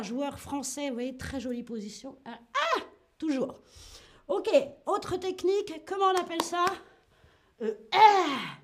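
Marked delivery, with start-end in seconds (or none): 2.2-2.8 high-pitched tennis-shot grunt. Shown as a demonstration. Imite le coup de tennis. 7.6-8.6 loud tennis-shot grunt; tennis player's cry. Shown as a demonstration. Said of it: "Autre cri aussi".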